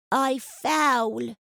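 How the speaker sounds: put-on a tiny child answering; toddler voice. A narrator's reading. Toddler answers her mother, little baby voice.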